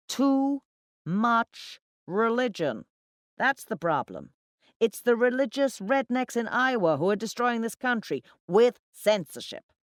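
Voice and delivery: loud, high, emphatic rant; talking too loudly. A narrator's reading. Drunken account manager ranting to colleagues, talking too loudly. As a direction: hammer out each point to the whole table. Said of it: "talking too loudly".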